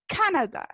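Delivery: Canadá said as CA-na-da; first-syllable stress. Shown as a demonstration. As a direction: hit the CA in Canadá, then let it fall. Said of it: "Marcamos la voz ... En la primera sílaba".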